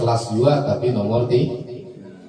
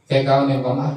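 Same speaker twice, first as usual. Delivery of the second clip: normal talking voice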